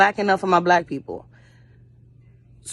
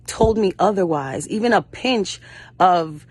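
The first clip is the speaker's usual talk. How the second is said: normal talking voice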